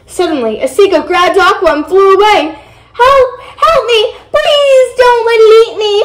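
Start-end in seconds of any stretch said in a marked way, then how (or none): 2.9-5.9 high, loud cry for help; storybook scream of terror, pitched way up; panicked scream. A narrator's reading. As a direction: plead shrilly for your life. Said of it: "Aqua screamed".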